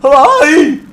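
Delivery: high, very loud garbled yell; hammed-up mangled shout; singer going off on one. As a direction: burst out imitating the singer's wild yelp. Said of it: "goes off on one".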